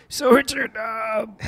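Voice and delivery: squeaky high interviewer voice; high mock interviewer. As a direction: play a silly high-voiced host. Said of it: "we'll be like".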